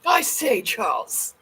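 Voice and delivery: fancy British call to a friend, falling away; old-time English gentry accent; posh old British accent. Pretend. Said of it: "Americans used to talk like that".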